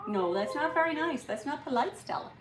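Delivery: gentle scolding. Real, not acted. Scolding the dog.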